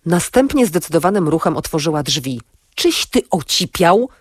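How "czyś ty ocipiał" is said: mild outraged yell, drawn out; restrained shout of slow disbelief; an understated, incredulous cry at her partner; shouted at someone. A narrator's reading. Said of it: "krzyknęła na Antka".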